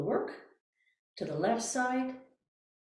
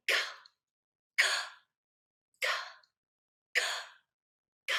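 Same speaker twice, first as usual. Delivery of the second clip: short k sounds gasped on the exhale; 4 dB quieter, much faster than usual. Shown as a demonstration. Teacher showing the class a gasped k sound. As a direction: flick a k off the diaphragm. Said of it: "Now I'm gonna k on an exhale".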